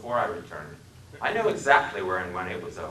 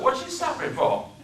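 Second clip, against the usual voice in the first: put-on 1 puzzled Southern accent; 3 dB louder than usual. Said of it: "His speech was the deepest South".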